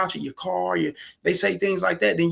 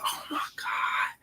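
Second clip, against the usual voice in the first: whispered shock; 9 dB quieter, slower than usual. Retold or imitated. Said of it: "She said".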